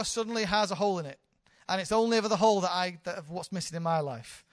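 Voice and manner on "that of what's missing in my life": usual speaking voice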